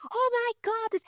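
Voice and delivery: very high-pitched mock childish excitement; overexcited kid. Speaker imitating a kid before dad says calm down, overexcited. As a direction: squeal it like a kid getting carried away. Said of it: "Remember when you're a kid".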